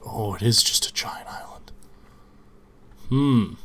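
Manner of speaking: ordinary delivery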